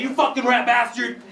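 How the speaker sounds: high-pitched angry mobster-movie outburst; mock rage. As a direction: hurl the insult in hammed-up rage. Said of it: "you get all the rage out".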